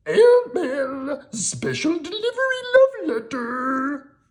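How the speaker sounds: very high, loud monster cry; Grover yelling. Acted. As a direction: Grover flying over the wall. Grover yells flying.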